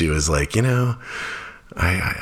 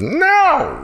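very high, louder mock toddler refusal; toddler's defiant no; 4 dB louder than usual. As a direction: squeal no like a stubborn toddler. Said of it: "She still sometimes goes".

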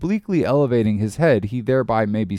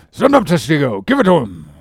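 loud, higher shouted order; welcome cry; 4 semitones higher, 6 dB louder than usual. A narrator's reading. Officer to his harpooner at the strike, shouted cry. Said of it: "the welcome cry was heard".

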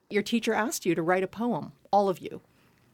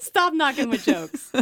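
louder, laughing, slow mock complaint; laughing protest; 6 semitones higher, 5 dB louder, slower than usual. Real, not acted. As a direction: laugh while you protest. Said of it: "laugh heard".

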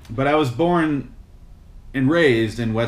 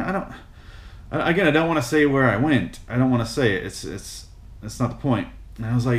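ordinary talk

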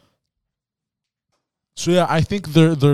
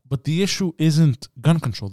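ordinary delivery

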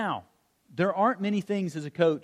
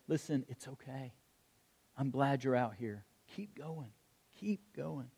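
very soft, comforting words; hushed, consoling encouragement with pauses; tender reassurance; 2 semitones lower, 12 dB quieter than usual. Acted. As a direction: encourage gently, almost under the breath. Holy Spirit comforting with embrace.

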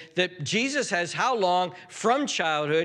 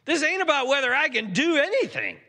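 high-pitched acted protest; indignant correction; 10 semitones higher, 4 dB louder than usual. Jesus calling the doubting father out, indignant. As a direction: push back hard, pitched way up.

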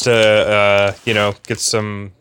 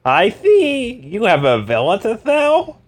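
high, slow knowing Carr voice; a much higher Carr voice; 11 semitones higher, slower than usual. Acted. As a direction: play it smug on the phone. Carr voice on the phone.

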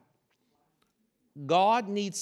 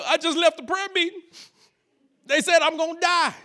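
high, whiny tearful report; hammed-up pitiful wail; mock crying; 9 semitones higher, 3 dB louder than usual. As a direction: imitate a son crying to his father. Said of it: "Why you crying?".

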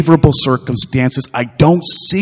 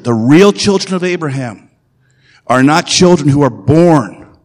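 normal talking voice

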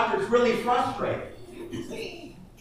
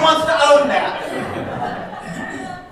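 laughing; 6 semitones higher, 6 dB louder, much slower than usual. Real, not acted. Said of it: "laughing through the line".

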